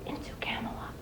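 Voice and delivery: a hushed, voiceless ending; whispered. Acted. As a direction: breathe it out in a whisper. Poem tells of silent drifting.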